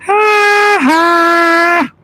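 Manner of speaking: high mocking "ha, ha" shouted; bully's jeering ha-ha, way up high; Nelson laugh. Streamer does his Nelson impression, high jeering shout. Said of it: "the best one I can do is probably Nelson".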